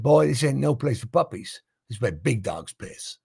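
lightly acted American racer, dropping low at the end; stern put-down. Veteran racer warns the British newcomer off, stern put-down. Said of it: "he said".

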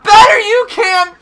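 high, loud cartoon voice; put-on character voice. Player voicing a selective talker, high loud voice. Reading game character's lines.

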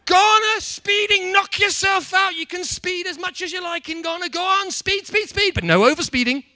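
big, loud, high-pitched rally cry; excited shouting. Acted. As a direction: shout it like a gleeful hype man egging the crowd on. Energetic riff on speeding in Ghana.

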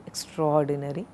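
EXTRAORDINARY merged as ek-STROR-dinary, ending on a rise; correct extraordinary. Shown as a demonstration. Teacher repeating the correction, extraordinary merged as ek-STROR-dinary. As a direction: join extra and or into one sound in extraordinary. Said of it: "it's not extraordinary".

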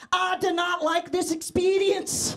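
put-on comic Scottish battle-hero accent. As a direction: protest in a hammed-up Scottish accent. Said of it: "Develop a Scottish accent like I'm Braveheart".

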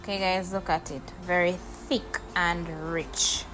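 normal talking voice

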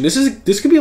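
stumbling over the words; stuttering. Real, not acted. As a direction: trip over the start of the sentence. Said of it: "I don't know why I'm stuttering".

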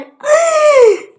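loud, drawn high squeal; sudden piercing yelp; high scream. Pretend. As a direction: shriek up high.

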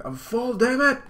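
high, loud stuffed yell; Luffy yelling that he's full. Acted. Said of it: "he's like".

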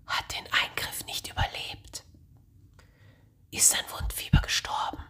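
whispered news. Acted. Monks whispering of the boy's death to each other. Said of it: "flüsterte man".

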